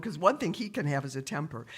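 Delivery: ordinary delivery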